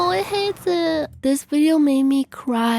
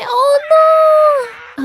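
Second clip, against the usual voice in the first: drawn-out high, loud "oh no"; alarmed cry; 10 semitones higher, 8 dB louder than usual. Real, not acted. Reacting as animal's trap closes.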